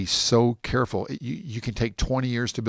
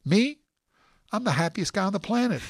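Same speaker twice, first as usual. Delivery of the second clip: high, beaming cabbie, pitched way up; delighted cab driver; 10 semitones higher than usual. Retold or imitated. Cab driver answering how he is, overjoyed. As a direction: gush like the happiest man alive. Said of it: "they give the same answer".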